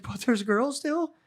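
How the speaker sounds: a wondering high plea; dazed high question. Retold or imitated. Imitating tripping Spud asking again, high and slow.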